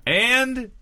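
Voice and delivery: high, held note of suspense; announcer's drawn-out "and". Pretend. Performer plugging a show, stretching for suspense. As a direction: hang on the word before the reveal.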